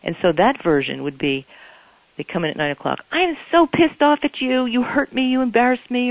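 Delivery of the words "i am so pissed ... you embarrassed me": high, louder mock accusation of an upset partner; hurt partner lashing out, voice pitched up and louder; angry partner. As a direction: play the wounded, furious partner venting. Partner arrives angry at nine.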